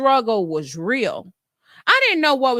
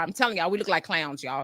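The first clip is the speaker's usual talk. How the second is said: ordinary talk, nothing marked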